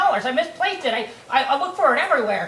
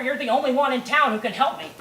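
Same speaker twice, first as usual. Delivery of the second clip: ordinary talk, nothing marked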